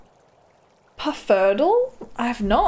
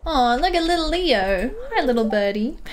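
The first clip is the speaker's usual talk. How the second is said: cooing at a pet; 7 semitones higher than usual. Real, not acted. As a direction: melt over the little bird. Cooing at game bird.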